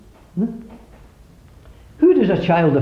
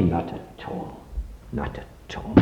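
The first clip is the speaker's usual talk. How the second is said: soft, lower, breathy refusal; mock whisper; 9 semitones lower, 12 dB quieter, slower than usual. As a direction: whisper it as the wrong version. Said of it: "She never said that".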